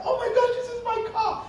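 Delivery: squealing high with thrilled delight; excited. Retold or imitated. Son seeing his new car for the first time, squealing with delight.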